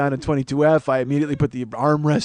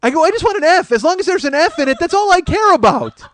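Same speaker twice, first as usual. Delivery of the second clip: high laughing explanation; laughing, higher; 12 semitones higher, 5 dB louder than usual. Retold or imitated. To the gate agent, laughing high and loud. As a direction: giggle through the answer, voice up.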